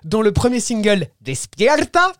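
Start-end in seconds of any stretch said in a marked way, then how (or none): 1.2-2.1 put-on Spanish accent. Announcing the singer's first single, in a mock Spanish accent. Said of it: "c'est le festival des accents".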